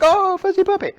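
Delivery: put-on high, loud baby-talk coo; cooing at a puppy. Cooing at the puppy in a high loud baby voice. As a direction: gush over the fuzzy puppy.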